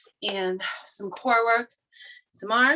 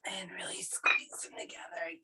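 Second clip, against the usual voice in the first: breathless whisper; 2 semitones lower, 11 dB quieter than usual. Real, not acted. Coach cueing during hard set.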